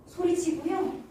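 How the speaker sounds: ordinary talk, nothing marked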